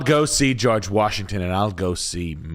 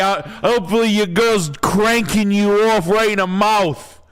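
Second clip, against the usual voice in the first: much higher, louder hammed-up New Jersey guy; filthy Jersey boy voice; 13 semitones higher, 4 dB louder than usual. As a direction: play a sleazy New Jersey dude for laughs. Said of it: "in your best filthy P O S Jersey boy voice".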